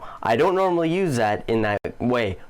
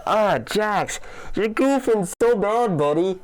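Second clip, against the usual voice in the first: high, mock-scolding jab at a goofball; teasing scold; 9 semitones higher than usual. Commentator teasing the Jack's intern: high mock scold. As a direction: wag a finger at a buddy who blew it, laughing at him.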